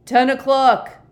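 slow, high, very loud bellow; booming curfew call; roar. A narrator's reading. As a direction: roar it out to be heard through a door. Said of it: "roared Jackson from outside the door".

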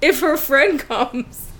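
giggling sheepishly about a selfish perk; laughing confession. Real, not acted. Mother confessing to friends, laughing sheepishly.

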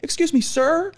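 high polite call; imitating her. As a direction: sing out to him up high. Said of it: "She's like".

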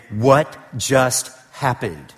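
slow, dazed disbelief; stunned visitor. Pretend. Shocked church visitor after a sudden death, slow and dazed. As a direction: let it hang in shock. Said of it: "every visitor right there is saying".

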